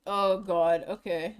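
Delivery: normal talking voice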